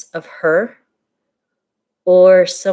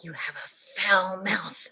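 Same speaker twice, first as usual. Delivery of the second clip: a slow, soft, hushed jab; whispered insult; 12 dB quieter, slower than usual. Retold or imitated. Sister whispering a parting insult, slow soft whisper. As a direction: hiss it under your breath as you leave. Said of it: "That's what she whispered on the way out".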